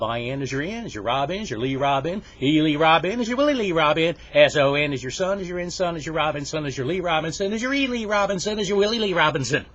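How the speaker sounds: high, loud, sing-song spelling chant; smart-kid recitation. Acted. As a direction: rattle it off like the star pupil. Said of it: "he's the smart guy in the class".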